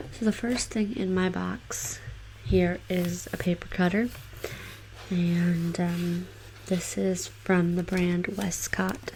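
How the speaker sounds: soft ASMR voice. Acted. Starts the ASMR tingles part.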